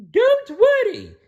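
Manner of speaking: high, slow reassuring cow; calm him from up high and slow. Acted. The cow reassures Blinky while blocking the door, high and slow. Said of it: "said the cow".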